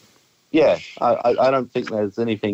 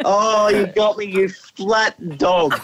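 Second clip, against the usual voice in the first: laughing shout; 11 semitones higher, much slower than usual. Real, not acted. Caller to the radio pranksters, laughing shout.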